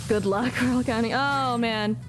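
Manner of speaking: usual speaking voice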